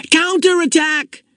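high, drawn-out rallying cry; opposing battle shout. A narrator's reading. Child answering the enemy side in a war game, shouting. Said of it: "came an opposing shout".

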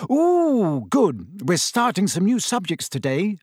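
high, bright eager delight; thrilled about new lessons; a schoolgirl delighted by her new timetable; happy. A narrator's reading. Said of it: "she said happily".

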